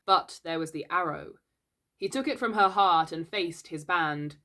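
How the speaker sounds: plain reading voice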